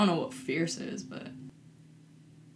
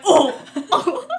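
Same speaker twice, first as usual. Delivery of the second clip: laughing; 10 semitones higher, 9 dB louder than usual. Retold or imitated. Reacting to being fallen on.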